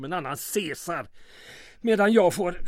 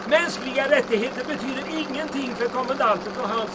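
shouting over wind; 7 semitones higher than usual. Acted. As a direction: call out above the rushing wind.

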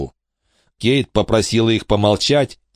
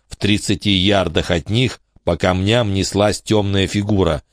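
ordinary delivery, nothing marked

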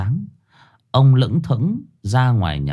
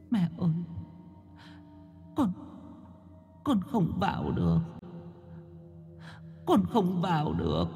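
faint, high-pitched wail of a weeping daughter; tearful sobbing; 9 semitones higher, 14 dB quieter than usual. Acted. Daughter telling mother she cannot enter, sobbing. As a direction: plead very softly, breaking into sobs. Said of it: "Linh lại càng khóc tợn hơn thút thít".

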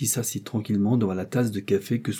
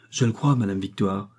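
ordinary delivery

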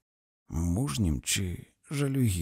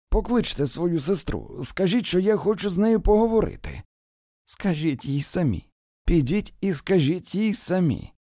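raised, high-pitched demands; heated quarrel; 9 semitones higher, 5 dB louder than usual. A narrator's reading. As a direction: argue loudly, pitch climbing. Acted quarrel on the veranda. (на підвищених тонах)

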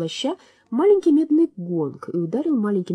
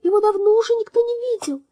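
very high, sweet bird voice; chirping little bird; 6 dB louder than usual. A narrator's reading. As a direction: chirp it like a tiny songbird. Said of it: "прощебетала маленькая птичка".

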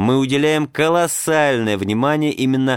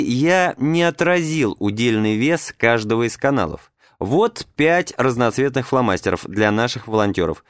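usual speaking voice

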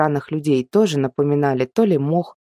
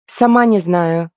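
put-on young girl voice; 2 semitones higher, 4 dB louder, slower than usual. A narrator's reading. Heroine shrugs, answers.

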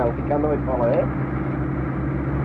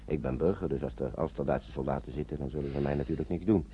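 hushed proposal; 10 semitones lower, 11 dB quieter than usual. Acted. Civilian proposes scouting village quietly.

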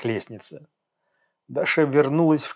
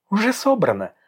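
smug; 8 semitones higher than usual. A narrator's reading. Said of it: "слишком довольно ответила Даша".

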